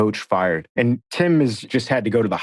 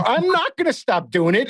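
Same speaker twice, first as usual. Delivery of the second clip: mock defiance; 12 semitones higher than usual. Retold gleeful refusal to Brad.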